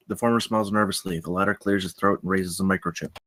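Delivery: ordinary talk, nothing marked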